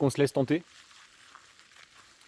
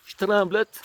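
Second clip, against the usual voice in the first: Je tremblote with a pitched-up, teasing Quebec twang; playful hint of Québécois on Je tremblote; mock Quebec accent; 7 semitones higher, much slower than usual. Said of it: "il faut pas se moquer des accents".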